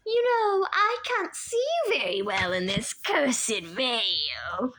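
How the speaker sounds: put-on sweet old lady voice. A narrator's reading. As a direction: coo it like a kindly grandmother hiding a threat. Auntie Em stalling.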